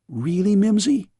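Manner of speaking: put-on surprised young princess; girl crying out. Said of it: "cried the girl".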